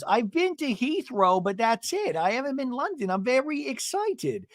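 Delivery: high-pitched caricature chatter; Gina impression. Gina telling of her London excitement, high imitated voice. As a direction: imitate excited talk, held high all through. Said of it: "And then Gina goes".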